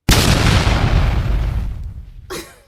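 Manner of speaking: a big breathy laugh bursting out; loud burst of laughter. Real, not acted. Laughing hard after her friend calls her the B word.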